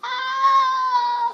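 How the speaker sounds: long, high acted cry; high wail. Mom despairing over the pizza, high wail.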